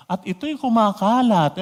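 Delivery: ordinary talk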